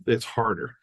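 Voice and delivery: usual speaking voice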